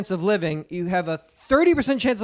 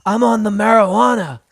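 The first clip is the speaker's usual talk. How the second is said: louder, laid-back bro voice; stoner bro; 4 dB louder than usual. Retold or imitated. Mocking how millennial clerks talk, a stoner bro voice. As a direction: play it like a proudly stoned clerk. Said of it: "that's how they talk".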